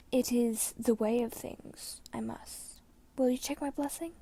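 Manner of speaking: soft, worn confession with whispered ends; dying old mentor. A narrator's reading. Brom calmly insists.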